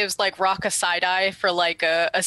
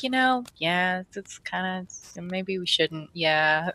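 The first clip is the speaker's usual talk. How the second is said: halting, softened mumble of agreement; awkward kinship; 4 dB quieter than usual. Acted. [name] relating to Bazig, awkward and unsure. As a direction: trail off into reluctant sympathy. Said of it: "a weird kinship moment with him".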